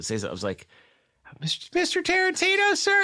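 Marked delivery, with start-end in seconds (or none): 1.4-3.0 very high, stammered mock-timid address; starstruck fan squeak. Retelling his timid fan approach.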